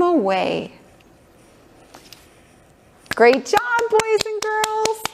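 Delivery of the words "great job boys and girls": excited praise. Acted. Praising the kids.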